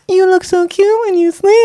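put-on high sweet baby talk; cutesy baby voice. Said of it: "They don't go like that".

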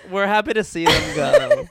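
laughing. Real, not acted. Giggling through joke.

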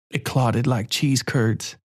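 plain reading voice

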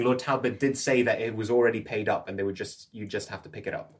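ordinary talk

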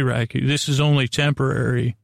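ordinary talk

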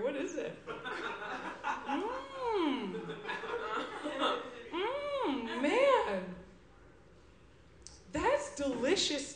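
dip-obsessed party guest. Acted. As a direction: mumble happily about the dip. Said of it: "this first character".

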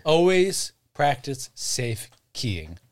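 slow, mock public service announcement; solemn safety slogan, slow; slow PSA voice. Slow PSA-style joke.

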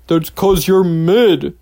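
slow, smug mock tease; teasing coworker. Teasing a coworker whose shift label says mid. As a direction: drag out the burn, savouring it. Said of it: "And I was like".

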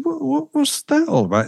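high, soft, bewildered question; baffled disbelief. Acted. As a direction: stammer it in puzzled mock surprise. Baffled friend in retold joke.

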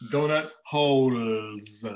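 slow, careful "Donut holes"; careful pronunciation. Shown as a demonstration. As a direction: spell out "Donut holes" slowly and clearly. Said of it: "I gotta watch how I pronounce that".

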